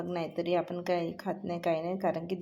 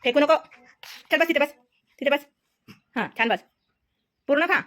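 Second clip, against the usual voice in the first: high, much louder coaxing and calling; 9 semitones higher, 10 dB louder, faster than usual. Real, not acted. Mother calling and coaxing toddler. (बाळाला मोठ्या लाडिक आवाजात बोलावणे)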